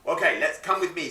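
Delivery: usual speaking voice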